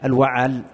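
"الوعل" with the middle consonant opened by a short "a"; fatha on the ayn. Shown as a demonstration. Teacher correcting the student's reading, models "الوعل" with fatha on the ع. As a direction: say "الوعل" as al-wa'al, a clear "a" after the ع. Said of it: "بفتح العاء".

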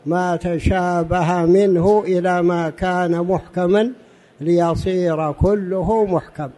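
ordinary talk

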